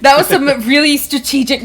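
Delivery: loud, laughing disbelief; loud amused outburst. Real, not acted. Game master reacting to the bold knock, loud and amused. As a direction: blurt it out loud, half laughing.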